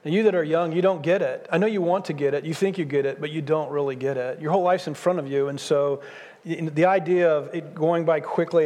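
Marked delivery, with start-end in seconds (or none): none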